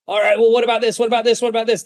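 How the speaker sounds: high, pushy haggling salesman; used car salesman. Shown as a demonstration. Closer mocking a desperate seller, used car salesman. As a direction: beg like a used car salesman dropping the price. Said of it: "You sound like a used car salesman".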